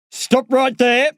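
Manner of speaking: a lightly voiced guard ordering; demanding. A narrator's reading. Prison guard orders the running boy to stop. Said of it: "the screw demands".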